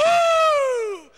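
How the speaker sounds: high loud whoop; mock worship whoop, high and loud; shout of joy. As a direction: let out a jubilant whoop. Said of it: "Grito de júbilo".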